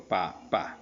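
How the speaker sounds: sílabas separadas "pa, pa", cada una por su lado; dividido en sílabas. Shown as a demonstration. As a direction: di "pa, pa" sílaba por sílaba, con pausa entre ellas. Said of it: "dividir silábicamente". ("pa, pa" silabeado)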